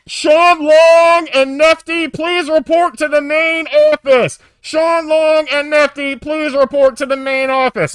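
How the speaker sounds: high, loud, drawn-out PA summons; booming monotone page over the intercom; intercom announcement. Retold or imitated. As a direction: imitate a camp loudspeaker, every word stretched. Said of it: "They had an intercom system there".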